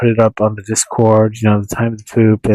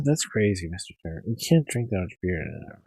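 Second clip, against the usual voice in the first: quiet; 3 semitones lower, 12 dB quieter than usual. Real, not acted. Hushed aside.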